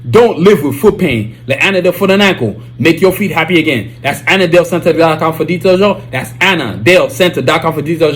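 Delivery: ordinary delivery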